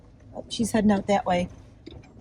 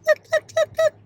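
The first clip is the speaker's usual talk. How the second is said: high excited call. Real, not acted. Excited calling to the turkey.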